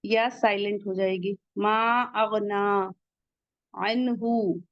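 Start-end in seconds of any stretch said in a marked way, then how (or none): 1.6-4.6 drawn-out Quranic recitation of मा अगना; slow recitation. Shown as a demonstration. Teacher recites the verse slowly for students. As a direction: stretch मा अगना slowly, vowels held. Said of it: "टू count पढ़ेंगे".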